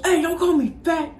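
high, indignant cartoon kid; Cartman. Cartman objects to being called fat, indignant kid voice. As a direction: protest in a high bratty cartoon voice. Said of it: "Carmen goes".

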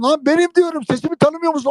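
high, loud desperate yell from inside the costume; desperate shout. Retold or imitated. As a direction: make them recognise your voice, shouting. (yüksek perdeden çaresiz bağırış)

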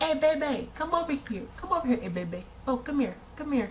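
high, mock dog-calling voice. Mocking a catcaller who beckons like to a dog, high coaxing. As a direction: coax in high repeated calls, as to a dog. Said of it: "like you're trying to call a dog over".

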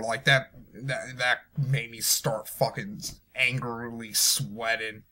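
ordinary talk

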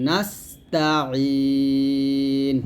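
নাস্তাইন low, pressed mid-throat, ee held long; pressed ain. Shown as a demonstration. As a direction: squeeze the ain in নাস্তাইন, then hold. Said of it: "মাঝখান থেকে চাপ দিয়ে বলবেন".

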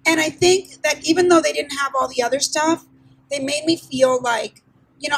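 ordinary talk, nothing marked

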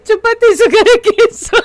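put-on sing-song it like a cheeky kid; mock taunt. Shown as a demonstration. Streamer hams up the taunt for chat, teasing. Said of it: "Lo voy a hacer".

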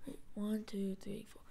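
very soft, low whispered count; counting under the breath. Real, not acted. Counting items to oneself.

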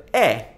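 "è" with an open vowel, as in egg. Shown as a demonstration. Teacher pronouncing the verb to be, open "è" as in egg. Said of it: "It's always an open è".

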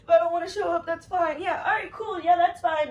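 loud, sarcastic mock of his carefree tone; sneering imitation of a careless boyfriend; mocking his attitude. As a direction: throw his own words back at him, scornfully. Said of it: "It's all".

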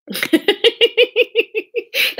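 high-pitched burst of giggling; real high giggle; laugh. Real, not acted. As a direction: laugh helplessly at your own attempt. Laughing at own bad accent.

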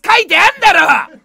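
loud comic snap with a laugh under it; half-laughing fake outrage; mock angry snap. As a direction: bite back at the viewer in playful fury. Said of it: "切れる生配信".

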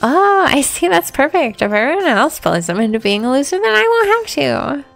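ordinary delivery

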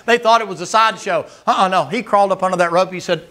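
ordinary delivery

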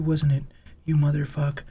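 normal talking voice

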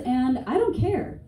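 ordinary talk, nothing marked

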